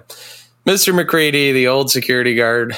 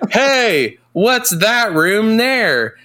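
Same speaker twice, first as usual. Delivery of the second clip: higher, slow, drawn-out curious call; 10 semitones higher, much slower than usual. Retold or imitated. Guard calling out about a mystery room, high and slow. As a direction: stretch it out, wide-eyed and nosy. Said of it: "he's like".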